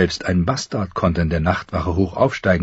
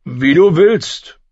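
slow, drawn-out and louder; cold official; 9 semitones higher, 4 dB louder, much slower than usual. Acted. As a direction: let him go with cool indifference. Marsh answers Jon coldly.

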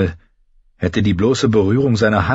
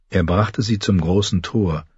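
plain reading voice

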